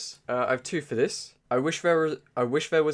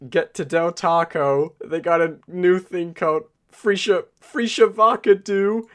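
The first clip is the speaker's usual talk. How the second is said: stretched-out excited mock announcement; hammed-up vine quote; 8 semitones higher, 9 dB louder than usual. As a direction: build it louder and higher to the end.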